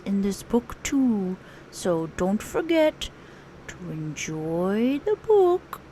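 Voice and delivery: gentle cartoon bear voice; lightly voiced Pooh Bear. Pooh introduces the teacher.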